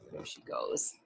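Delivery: soft whispered aside. Acted. Storyteller whispering to listening kids. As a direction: breathe it under the story.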